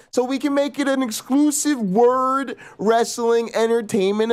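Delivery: high, hyped wrestling promo voice; wrestling promo hype. Acted. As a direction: hype it like a title fight promo. Wrestling promo hype about fight.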